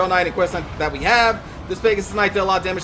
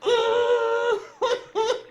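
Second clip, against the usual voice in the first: high, helpless laughing fit; high cracking laughter; hysterical laugh. Real, not acted. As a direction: laugh in despair. Laughing at own mistake.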